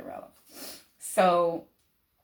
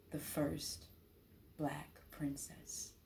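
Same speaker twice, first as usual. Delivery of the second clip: whispered slowly; hushed reveal; 13 dB quieter, much slower than usual. Real, not acted. Revealing her lost role, whispered. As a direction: breathe it as a secret. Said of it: "dramatic reveal".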